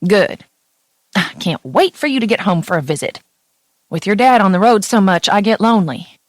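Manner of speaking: a homesick Southern mama on the phone; Tennessee mom accent. Acted. Mother telling her daughter she is lonely, in a light Tennessee accent. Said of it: "My mom's accent burst through the phone".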